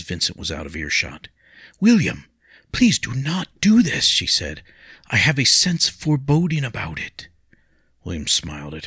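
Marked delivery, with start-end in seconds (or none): 1.8-4.0 high, louder anxious plea; pleading young lady. Acted. Lady begs William not to fight, high and pleading. 5.1-7.3 high, louder anxious plea; pleading young lady. Acted. Lady begs William not to fight, high and pleading. Leah continues.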